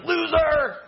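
high, loud playground taunt; mock taunt. Dad taunting his child, a loud mock jeer. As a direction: jeer it like a cheeky kid. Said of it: "be like".

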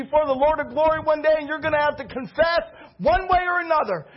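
heated warning. Retold or imitated. Baker lecturing the rude pastor across the counter, heated. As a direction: warn him hotly, nearly shouting. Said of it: "almost came over the counter".

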